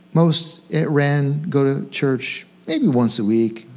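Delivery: ordinary delivery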